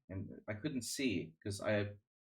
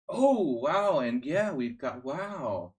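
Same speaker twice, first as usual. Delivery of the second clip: exaggerated swooping amazement, high and loud at first; mock surprise; 5 semitones higher, 9 dB louder, much slower than usual. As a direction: imitate a commentator amazed by his own words. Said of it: "He's surprised by everything he says".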